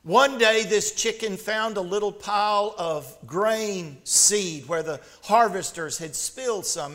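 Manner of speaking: usual speaking voice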